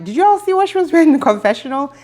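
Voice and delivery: ordinary delivery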